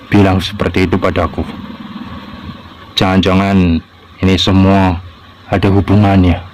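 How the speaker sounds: reflective thinking-aloud voice; inner monologue. Acted. Child alone wondering about Papa's words, reflective inner voice. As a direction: muse to yourself, worried.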